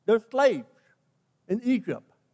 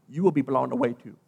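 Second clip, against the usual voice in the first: ordinary delivery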